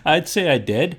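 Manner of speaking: a laugh, then the answer through it. Real, not acted. Answering the podcast hosts through a real laugh. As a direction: chuckle out a modest brag. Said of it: "laughing reply".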